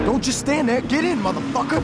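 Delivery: high, loud yell to hurry up; yelling at a frozen partner to get in; urgent shout. Acted. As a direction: bark him into the car.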